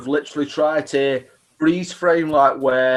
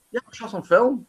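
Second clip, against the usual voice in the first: dry mock reply, higher; sarcastic; 7 semitones higher than usual. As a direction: say it as if it's obvious. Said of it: "it's like".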